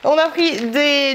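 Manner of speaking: usual speaking voice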